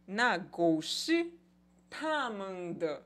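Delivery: "Na gou shi ta men de" spoken slowly, syllable by syllable, in Mandarin tones; slow Mandarin demo. Shown as a demonstration. Teacher to students, modelling the sentence slowly and clearly. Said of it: "aquele cachorro é deles".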